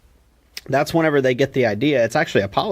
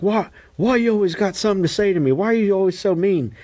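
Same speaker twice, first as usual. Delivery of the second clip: higher, fast mock Rocky complaining; imitating Rocky, pitched up and rushed; whiny Rocky; 7 semitones higher, faster than usual. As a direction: rattle it off like a hurt palooka. Rocky pleading with Mickey, retold.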